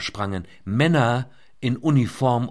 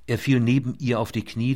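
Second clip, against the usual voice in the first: usual speaking voice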